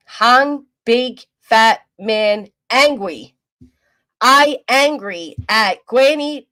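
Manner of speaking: slow, loud, dumb caveman talk; caveman mock voice. Mocking a man on stream in slow loud caveman talk. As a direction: stomp through it like an angry caveman.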